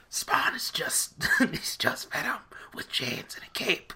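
breathy whispered aside. Real, not acted. Joking to friends that Spawn copies Venom, whispered. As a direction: lean in and whisper it like a secret joke.

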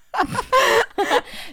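sudden high burst of laughter; loud high laugh. Real, not acted. Laughing at her own joke about pretty men. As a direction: crack up laughing.

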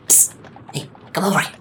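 hushed "psst", then a high call; a stranger beckoning from the bushes; girl whispering from the bushes. Acted. Girl in the bushes to the party, hushed beckoning. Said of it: "throwing rocks at you and goes".

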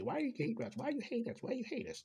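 put-on high-pitched child voices asking over and over; mock high kid voice, repeated insistently; imitated haunting kids. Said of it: "as they ask you every day".

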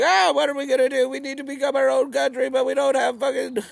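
very high, fast fretting; panicky high mock voice. Ukrainians fretting about no sea access, high and fast. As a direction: rattle it off in a worried squeak. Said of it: "They were like".

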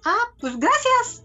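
agradecimiento alto y lento, sin darle importancia; reacción indiferente. Retold or imitated. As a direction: di gracias como si nada te tocara. ("ah, pues gracias" agudo y despreocupado)

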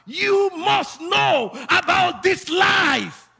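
high, loud prophetic preaching; full-force preaching. Real, not acted. Preacher prophesying life over the congregation, full-force preaching. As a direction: declare it in long shouted cries.

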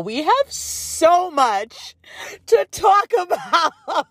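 laughing through the words; giggling as she says it. Real, not acted. Opening excited restart, laughing.

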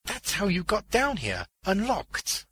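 high-pitched dawning realization; astonished old man; dumbfounded realization. A narrator's reading. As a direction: work it out aloud, amazed. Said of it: "the old man looked dumbfounded".

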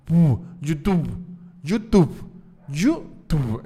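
YouTube repeated with a clearly pressed two-lip B; lips-only YouTube. Shown as a demonstration. Comedian drilling the corrected YouTube for listeners. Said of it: "Tengo que hacer los labios así".

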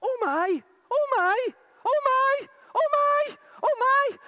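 high loud repeated cry of amazement; excited shouts of oh my; retold shouting. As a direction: cry out in astonishment, rising. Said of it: "she started shouting".

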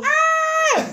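very high mock scream; inner panicked scream. Student panicking inside about the office, high scream. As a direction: squeal in comic panic. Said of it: "por dentro estaba como".

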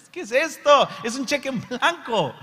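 high, loud, astonished; gasping wonder at a blank cheque; mock amazement. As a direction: marvel at it like a treasure. Preacher marvels at the promise.

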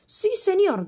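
high, loud eager reply; eager yes. Acted. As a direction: jump to obey. Queen obeys king.

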